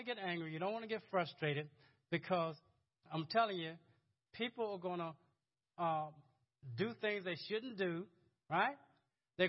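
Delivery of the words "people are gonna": ordinary talk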